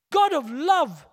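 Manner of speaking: ordinary talk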